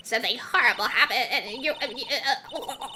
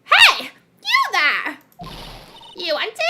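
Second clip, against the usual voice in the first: shrill high shouting crone guard; old hag; 7 semitones higher, 8 dB louder, slower than usual. Acted. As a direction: bark it out like a cranky old hag. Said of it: "how do I do my old hag voice?".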